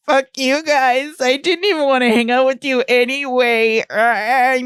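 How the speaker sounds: hammed-up tantrum in a squeaky pitch; mock Envy outburst. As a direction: put on a high, petulant villain voice. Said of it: "goes from begging to being like".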